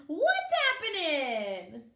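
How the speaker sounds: loud, slow, drawn-out holler; a big booming hey-there; blaring greeting. Acted. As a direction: blare it across the ice. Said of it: "blared Tacky".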